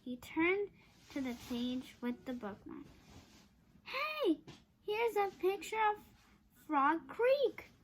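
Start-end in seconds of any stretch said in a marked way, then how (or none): 3.8-7.7 excited discovery. A narrator's reading. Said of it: "said Jack".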